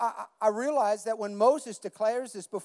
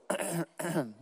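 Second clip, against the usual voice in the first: a pointed little ahem; throat clearing; 4 dB quieter than usual. Retold or imitated. The Holy Spirit nudging the pastor, pointed throat clear. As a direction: clear the throat to get attention. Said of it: "like clearing his throat".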